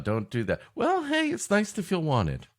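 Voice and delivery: usual speaking voice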